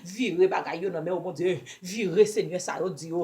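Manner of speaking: ordinary delivery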